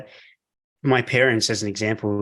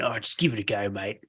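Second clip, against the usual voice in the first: a light, quiet Australian "Oh, just give it a go, mate"; Australian accent; 5 dB quieter than usual. Shown as a demonstration. Host jokingly redoing the guest's motto, light Australian accent. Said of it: "You gotta say that with a really Australian accent".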